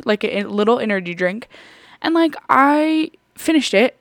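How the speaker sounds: usual speaking voice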